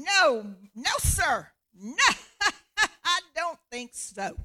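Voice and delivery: loud, high "no, sir" with a laugh; mock refusal. Peter turning God down a third time, loud and laughing. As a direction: laugh off the request. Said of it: "the third time".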